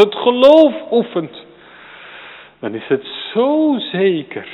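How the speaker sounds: swelling pulpit fervour; fervent preaching. Real, not acted. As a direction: preach it out with full conviction. Preaching at full force.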